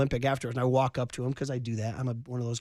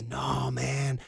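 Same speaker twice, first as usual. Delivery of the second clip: party caddy; much slower than usual. Retold or imitated. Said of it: "He goes".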